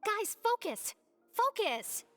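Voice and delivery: high-pitched, insistent call to order; exasperated scolding. Acted. Taskmaster pulling daydreaming colleagues back, exasperated scolding. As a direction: call them back to task, high and sharp.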